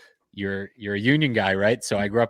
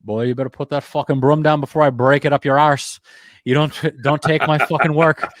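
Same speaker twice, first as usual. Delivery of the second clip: put-on loud, higher Irish laborer threatening in his accent; 6 semitones higher, 8 dB louder than usual. Union laborer threatens young super, loud Irish accent. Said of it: "with the Irish accent".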